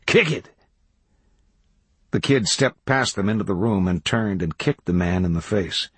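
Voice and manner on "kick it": short, high urgent command; high-pitched egging on; called order. A narrator's reading. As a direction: call it out sharply mid-struggle. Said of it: "called Toadvine".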